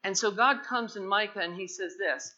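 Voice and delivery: ordinary talk, nothing marked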